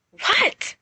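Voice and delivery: very high sharp demand. Acted. Narcissa whirling on screaming Carmina, sharp demand. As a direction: snap the question at her. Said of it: "Narcissa demands".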